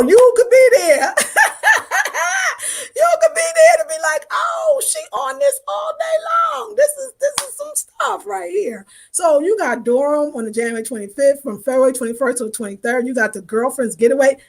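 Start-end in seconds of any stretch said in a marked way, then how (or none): 0.0-8.9 very high-pitched, giddy shriek of laughter; laughing squeal. Real, not acted. Host teasing viewers about joining the getaway, laughing squeal. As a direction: squeal the words through laughter.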